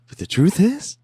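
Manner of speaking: playful falsetto overdub; squeaky dub. Pretend. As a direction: pop up into a squeak. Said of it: "I'm gonna do the A D R".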